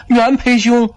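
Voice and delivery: high-pitched call; calling out loudly. A narrator's reading. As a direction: call his name high and long across a distance. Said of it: "高声喊道".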